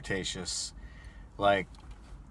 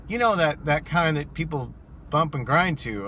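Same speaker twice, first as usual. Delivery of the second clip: a hint of a giddy tipsy woman, higher and louder; lightly voiced drunk passenger; 6 semitones higher, 4 dB louder than usual. Drunk passenger explaining music to the driver, mild tipsy mimicry.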